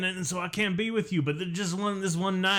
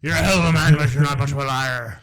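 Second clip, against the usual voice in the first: hammed-up Irish brogue, louder; mock Irish accent; 9 dB louder than usual. Mocking a character's line in a hammed-up Irish accent. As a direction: lay on a thick fake Irish accent. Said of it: "I have a weird Irish accent".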